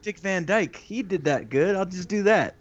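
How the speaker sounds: put-on imagined accent copier. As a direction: play someone pleased with a bad idea. Voicing an imagined accent pupil.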